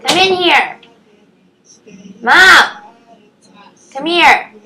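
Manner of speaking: high, drawn-out calls; calling for mom. Real, not acted. Vlogger calling mom to come in, high calling voice. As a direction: call into the next room, rising in volume.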